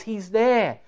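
normal talking voice

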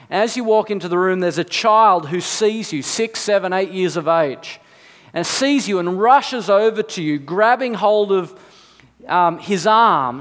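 ordinary talk

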